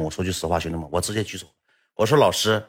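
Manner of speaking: usual speaking voice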